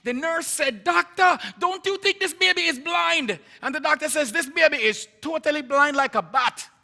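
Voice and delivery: loud, high Jamaican retelling; dramatic Jamaican aunt, pitched well up and building in volume; aunt's Jamaican accent. Retold or imitated. Aunt telling Lennox the diagnosis she overheard, emphatic Jamaican accent. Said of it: "she said in her Jamaican accent".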